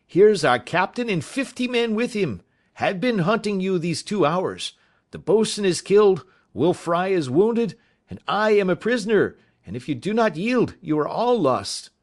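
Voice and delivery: high, loud warning call; urgent shout. A narrator's reading. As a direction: shout the news to make them yield. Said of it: "says he".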